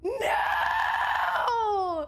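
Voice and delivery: a desperate half-sobbing no; anguished shout. Real, not acted. Streamer learning her record player needs speakers, crying out loud.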